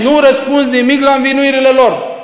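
high, loud exasperated demand; annoyed Pilate. Retold or imitated. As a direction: bark it out like an irritated governor. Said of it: "l-a enervat pe Pilat".

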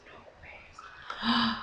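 breathless whisper of disbelief; barely voiced, as if you can't believe it; stunned whisper. Real, not acted. Stunned by a gift.

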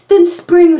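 high, loud eager shout; excited puppy. A narrator's reading. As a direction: call it out like a happy puppy. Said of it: "said the fourth puppy".

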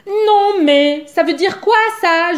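offended blackbird protesting loudly; indignant outburst. A narrator's reading. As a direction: blow up at the ungrateful passengers. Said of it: "s'insurge Zigomar".

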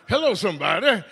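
ordinary delivery

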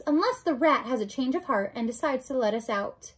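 ordinary delivery, nothing marked

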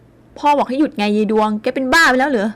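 slow, loud commanding shout; stern shout. A narrator's reading. Father shouting at his raging daughter to stop. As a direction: order her to stop.

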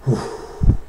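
normal talking voice

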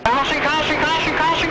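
high, urgent radio call; urgent caution call. Real, not acted. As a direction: shout the caution fast and high. Race official calling a caution.

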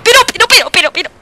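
loud, high rapid-fire repetition; frantic sputtering. Real, not acted. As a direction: sputter in helpless protest. Enemy will not die.

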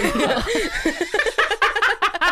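a long high shriek of laughter. Real, not acted. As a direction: crack up at the tweet. Laughing at the tweet.

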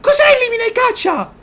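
very high, loud disbelieving cry; incredulous shriek. Real, not acted. Player baffled by new objective, shrieking incredulously. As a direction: screech the question in disbelief.

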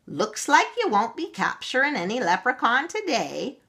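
a little Irish leprechaun taunting; mocking Irish voice. A narrator's reading. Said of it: "mocked the little Irish voice".

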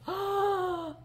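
high gasp of wonder; delighted gasp. Real, not acted. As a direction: gasp as if you found treasure. Sees Cupid necklace.

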